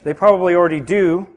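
ordinary talk, nothing marked

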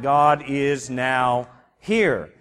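God is now here, slow and weighty with a pause; slow, deliberate reading. Shown as a demonstration. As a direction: read it out slowly as the correct meaning. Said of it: "safe to translate that".

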